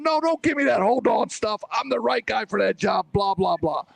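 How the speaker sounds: high-pitched mock fury at the future boss; retold angry rant. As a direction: replay the outburst, hammed up and high. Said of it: "I'm going off a little bit".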